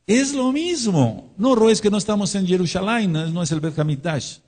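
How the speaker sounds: high mocking voice of a doubter; mock objector. Imitating an objecting listener.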